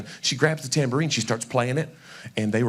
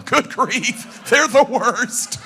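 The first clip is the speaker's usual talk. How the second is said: high, loud, drawn-out dismay; hammed-up disgust, stretching the last word; mock exasperation; 10 semitones higher, 5 dB louder, much slower than usual. Tennesseans looking down on Alabama, mock appalled.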